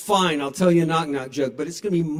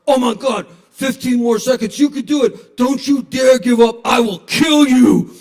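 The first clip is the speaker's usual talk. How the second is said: screaming drill-sergeant trainer, louder and higher; boxing coach yelling; 8 semitones higher, 11 dB louder than usual. Retold or imitated. Fitness coach screaming at the viewer to keep going. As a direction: bellow at them to push through. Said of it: "the boxing guy's like".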